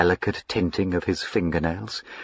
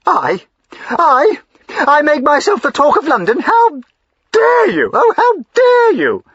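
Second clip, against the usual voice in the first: much higher and louder, rising to a tearful cry; outraged; 7 dB louder than usual. Acted. Wife hurling his accusation back at him, tearful outraged cry. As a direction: shriek in wounded outrage.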